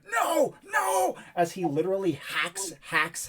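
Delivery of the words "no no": high-pitched screamed cry of a knight; desperate scream. Acted. Game master voicing a knight hacking at a monster, screaming.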